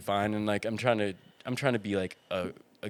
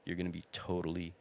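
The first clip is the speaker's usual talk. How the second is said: soothing reassurance; 4 semitones lower, 9 dB quieter than usual. Retold or imitated. Calming a panicking kid.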